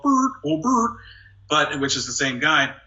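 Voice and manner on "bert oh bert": much higher Muppet whine; high Ernie voice. Retold or imitated. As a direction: call out to Bert like Ernie. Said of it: "which is Ernie as well".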